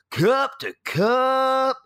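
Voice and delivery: high, drawn-out tailgate holler; imitated scream. Tailgater teaching the toast, imitated drawn-out scream. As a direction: bellow it slowly as a mock rallying cry. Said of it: "you have to scream".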